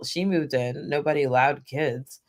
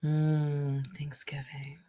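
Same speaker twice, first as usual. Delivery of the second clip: dreamy murmur; 9 dB quieter, much slower than usual. Real, not acted. Dreamy echo of a word.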